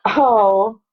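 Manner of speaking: normal talking voice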